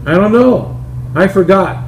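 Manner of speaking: a hint of a sheepish old man; forgetful [name].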